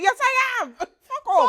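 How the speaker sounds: high, loud retort; mock defiant outburst. Answering an ex who called her rude, mock defiance. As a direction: own it in a hammed-up shriek. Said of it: "Bro, you're rude".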